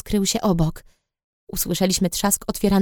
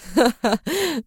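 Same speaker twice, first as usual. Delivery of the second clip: laugh; 5 semitones higher than usual. A narrator's reading. Lin laughs.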